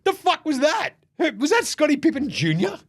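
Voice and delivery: high, incredulous squeal; mock LeBron disbelief; baffled LeBron. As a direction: play stunned outrage. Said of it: "He's like".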